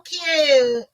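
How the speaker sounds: high, slow, sugary coo; cooing compliment. Retold or imitated. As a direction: gush over someone adorable. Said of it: "That's what they say".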